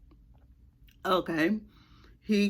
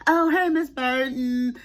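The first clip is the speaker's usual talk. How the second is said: imitating a sugary, higher hello; fake-friendly greeting; 6 semitones higher, 3 dB louder than usual. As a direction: sweet-talk the teacher's mom, a bit higher. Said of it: "like that, like that".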